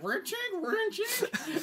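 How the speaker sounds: very high, slow squeaky machine sound; mock machine noise. The jerk-off machine pumping away, high slow squeak. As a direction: drag out a squeaky mechanical whine.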